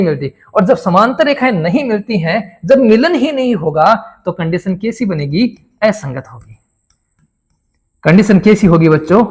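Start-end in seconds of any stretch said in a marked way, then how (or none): 5.8-6.6 quiet class answer. Pretend. Teacher voices class answer.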